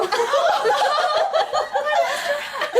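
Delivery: a long, high ringing laugh; bright laugh. Acted. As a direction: laugh at her own joke. Laughs at her own joke.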